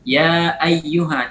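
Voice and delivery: ya ayyuha read slowly, every sound distinct; slow careful Quran reading; clear recitation. Shown as a demonstration. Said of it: "harus kita baca dengan jelas".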